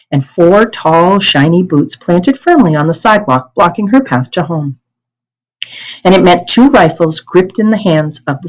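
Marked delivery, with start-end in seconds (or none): none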